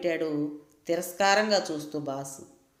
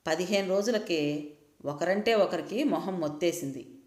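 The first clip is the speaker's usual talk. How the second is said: ordinary delivery, nothing marked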